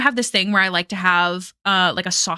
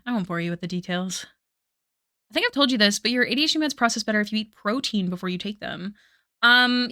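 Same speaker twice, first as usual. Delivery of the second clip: ordinary delivery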